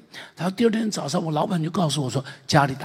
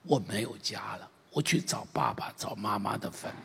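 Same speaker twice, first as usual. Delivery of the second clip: softer, understated tearful telling; a touch of weeping in a hushed voice; retold crying words; 6 semitones lower, 7 dB quieter than usual. Orphan tells the old man his grief, mildly tearful and soft. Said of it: "一边哭一边说".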